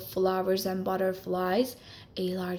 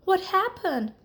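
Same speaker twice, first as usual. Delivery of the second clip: startled, higher question; surprised; 9 semitones higher than usual. Acted. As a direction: sound caught off guard.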